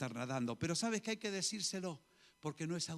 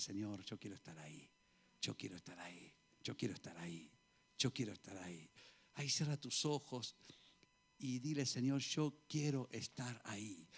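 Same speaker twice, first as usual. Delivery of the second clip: hushed prayer; 6 semitones lower than usual. Acted. Pastor modelling a hushed prayer for the congregation. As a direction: murmur it to God with eyes closed.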